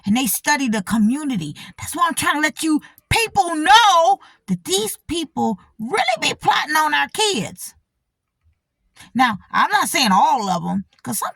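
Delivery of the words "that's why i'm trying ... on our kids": raised, higher warning voice; higher-pitched, raised warning to the viewers; urgent warning. Real, not acted. Warning her viewers, rising up.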